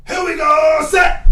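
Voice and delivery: high, loud drawn-out snap count; quarterback cadence shout. Pretend. Player calling cadence before a throw, high drawn-out shout. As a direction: holler it long and high like a quarterback.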